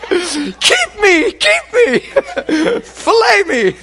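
very high squeaky begging through laughter; pleading fish. Pretend. Fish leaping into the boat begging the fisherman, squeaky and laughing.

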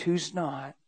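ordinary delivery

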